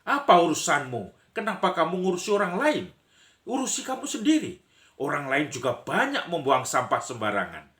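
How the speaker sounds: high, loud, fast retort; indignant mind-your-own-business yell; acted angry defender. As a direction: play the litterer's friend jumping into the quarrel. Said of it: "datang juga pembantu dari orang".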